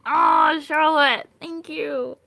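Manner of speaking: loud, high burst of gratitude; touched gratitude. Real, not acted. Streamer thanking a viewer for praise, loudly touched. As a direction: gush a real thank-you, high and loud.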